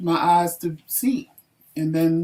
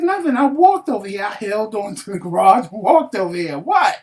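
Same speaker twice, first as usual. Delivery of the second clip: defiant innocence; 4 semitones higher, 5 dB louder than usual. Retold or imitated. Defiant reply to angry husband.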